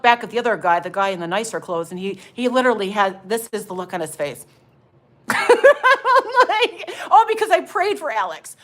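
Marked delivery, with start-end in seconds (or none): 5.3-8.6 high, giggly burst of words; cracking up mid-story; laughing. Real, not acted. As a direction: talk through a real laugh. Cracks up at the man's look.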